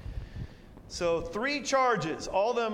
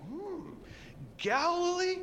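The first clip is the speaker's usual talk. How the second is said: much higher hmm of discovery; sly realization; 11 semitones higher, slower than usual. Retold or imitated. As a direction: perk up at an idea. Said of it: "he's like".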